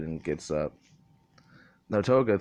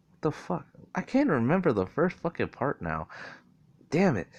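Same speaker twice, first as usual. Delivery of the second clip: higher-pitched exasperation at yourself; exasperated, voice pitched up; frustrated; 6 semitones higher than usual. Real, not acted. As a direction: vent at your own lapse in memory. Loses track of own story.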